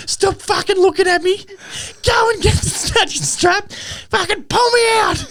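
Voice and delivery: high, slow mock yell through laughter; bogged driver yelling at laughing mates, mock fury; mock angry yell. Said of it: "and he's like".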